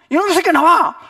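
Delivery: high, loud yell; furious shout. Retold or imitated. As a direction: roar at him to come out. Father yelling at offender.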